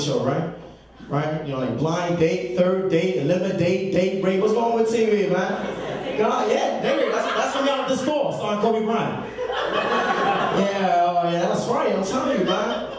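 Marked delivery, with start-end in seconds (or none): none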